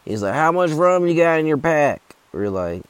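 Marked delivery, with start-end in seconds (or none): 0.3-2.0 put-on high, loud old hiker voice; hammed-up high old man; old guy voice. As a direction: put on the old-timer demanding rum. Old guy voiced in hiking story.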